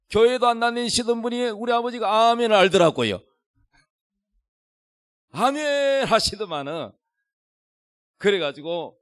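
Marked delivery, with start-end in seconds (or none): 5.3-6.0 imitated high, drawn-out amen; loud amen. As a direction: call out the father's amen, stretched long. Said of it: "아버지가 큰 소리로".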